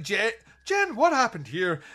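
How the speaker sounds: hammed-up alarmed call. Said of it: "he's like".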